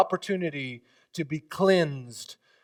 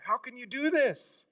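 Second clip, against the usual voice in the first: higher, amazed question; Peter's astonishment; 10 semitones higher than usual. Acted. Peter questioning Jesus in amazed disbelief. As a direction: marvel at it like Peter. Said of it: "That's the attitude of Peter".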